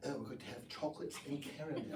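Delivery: soft, quick mock-British accent; hushed, playful British accent. Shown as a demonstration. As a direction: lightly put on a refined British accent. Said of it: "saying this British accent".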